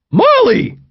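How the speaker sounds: loud, very high-pitched call. A narrator's reading. John stopping in his tracks to call the dog, calling out. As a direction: holler the name across a distance.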